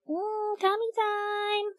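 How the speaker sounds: high, soft, drawn-out coo; playful coo. Pretend. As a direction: coo it slowly in a high little voice. Playful cooing over tummy.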